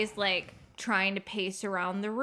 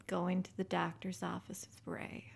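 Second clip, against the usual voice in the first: whisper; 8 dB quieter than usual. Real, not acted. Teasing confession about partner.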